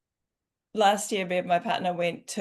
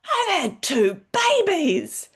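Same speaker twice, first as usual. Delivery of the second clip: much higher and louder, slow at first, laughing through it; self pep talk; 12 semitones higher, 7 dB louder, slower than usual. Retold or imitated. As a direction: pump yourself up like a mother who can do anything. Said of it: "in those moments I'm like".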